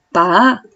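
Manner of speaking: PA rising steadily; second tone. Shown as a demonstration. Teacher showing tones of pa, PA rising. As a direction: glide upward on PA. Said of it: "Primer tono".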